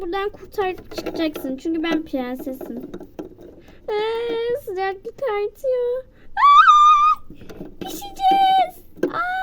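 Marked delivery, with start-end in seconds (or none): 6.4-9.4 very high, loud panicked shriek; panicked scream. Acted. Doll shrieks in panic as the heat rises. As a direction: scream like you're about to be cooked. Characters heating up, scared.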